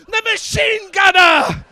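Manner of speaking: high, drawn-out comic yell; exasperated mock bellow; mock shout. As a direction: shout it slowly, high and loud. Acted shouted answer.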